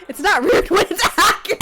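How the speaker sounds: loud, high words dissolving into laughter; helpless laughing. Real, not acted. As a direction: crack up while protesting. Defends herself while cracking up.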